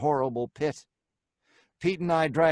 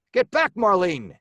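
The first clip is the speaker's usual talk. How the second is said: yell; 5 semitones higher, 4 dB louder than usual. A narrator's reading. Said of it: "I yelled".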